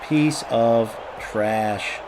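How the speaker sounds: drawn-out, low put-down with spaced words; very slow insult. Real, not acted. Player insulting an opponent in the game, very slow and low. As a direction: stretch each word right out, low and slow. Same insult repeated, slower still.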